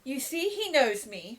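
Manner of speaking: slow pleading call; lightly voiced Nancy; Nancy appealing to the crowd; appealing to bystanders. A narrator's reading. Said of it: "cried Nancy, appealing to the bystanders".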